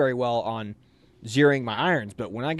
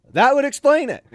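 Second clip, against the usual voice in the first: dry sergeant reply; 14 semitones higher, 9 dB louder than usual. Retold or imitated.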